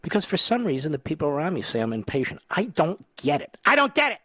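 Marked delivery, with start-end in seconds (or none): 3.7-4.2 jumps much higher and louder in a hammed-up whine; mock exasperation. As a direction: play it as a joke of being fed up, high and loud. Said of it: "Oh, just kidding".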